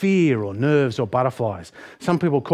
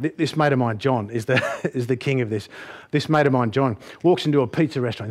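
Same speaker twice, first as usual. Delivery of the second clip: usual speaking voice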